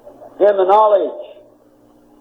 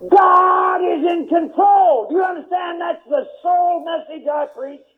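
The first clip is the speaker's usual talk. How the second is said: slow, higher, shouted sermon climax; full-force pulpit preaching; fiery preaching; 8 semitones higher, slower than usual. Acted. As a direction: thunder it at the congregation.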